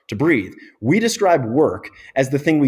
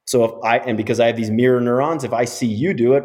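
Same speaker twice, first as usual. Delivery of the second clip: ordinary talk, nothing marked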